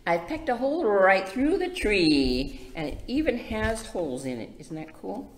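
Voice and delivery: lively, sing-song picture book reading; reading to children. Acted. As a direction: read it bright for little ones. Reads picture book aloud to kids.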